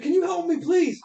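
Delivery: high, pleading voice of a lost man; high voice of a naked stranger lost in the woods, asking for help. Acted. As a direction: beg them for help in a thin high voice. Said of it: "He's like".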